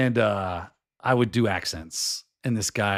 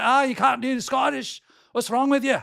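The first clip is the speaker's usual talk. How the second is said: high, mock-outraged Glaswegian accent; complaining Glasgow local's Scottish accent; indignant Scottish accent; 12 semitones higher, 3 dB louder than usual. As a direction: scold someone in a Scottish brogue, pitched right up. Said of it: "people from Glasgow showing up on my doorstep".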